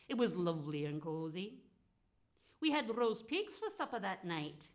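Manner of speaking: usual speaking voice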